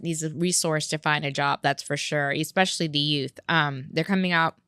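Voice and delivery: talking around ice in the mouth; words muffled by a mouthful of ice. Real, not acted. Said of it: "sorry, I got ice in my mouth".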